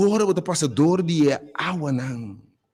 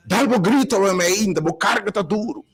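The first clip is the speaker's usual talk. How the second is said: fervent preaching; 5 semitones higher than usual. Acted. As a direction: preach it over the congregation with rising fire. Pastor preaching a prayer on air.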